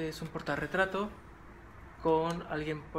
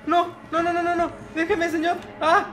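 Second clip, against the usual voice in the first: much higher, louder panicked begging; terrified pleading; 13 semitones higher, 9 dB louder than usual. Real, not acted. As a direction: beg the man to stop. Lo persigue el enemigo. (súplica aterrada y aguda)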